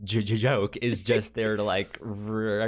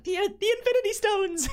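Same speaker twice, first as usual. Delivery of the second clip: put-on eager kids; 4 dB louder than usual. Kids answer teacher eagerly.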